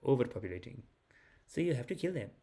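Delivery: a hint of a mild-mannered cousin; lightly imitated polite cousin. Cousin politely complains about Muslims overpopulating, polite imitation. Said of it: "He's so polite, too".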